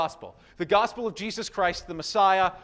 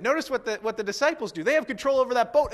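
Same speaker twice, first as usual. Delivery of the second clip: ordinary delivery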